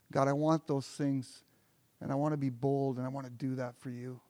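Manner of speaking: soft, earnest prayer voice; quiet prayer. Acted. Believer asking God for boldness, a soft earnest prayer. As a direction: pray it softly and sincerely.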